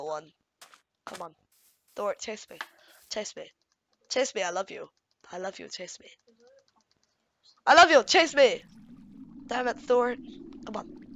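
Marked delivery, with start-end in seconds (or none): 3.1-6.1 low, very quiet plea; hushed, lower murmur; soft coaxing. Real, not acted. Coaxing the pet hamster. 7.7-8.6 louder, livelier call to a pet; raised-voice plea; insistent calling. Real, not acted. Calling the hamster after failed coaxing.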